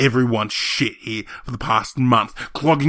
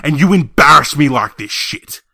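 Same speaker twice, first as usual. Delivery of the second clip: raised, emphatic indignant rant; angry outburst; 12 semitones lower, 7 dB louder than usual. Acted. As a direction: let pent-up grievances burst out. Acted furious rant at relatives.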